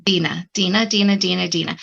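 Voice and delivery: Dina repeated in a run, each DEE-nah; practising the name. Real, not acted. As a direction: drill Dina over and over with a long "ee". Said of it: "Correct me as much as you need".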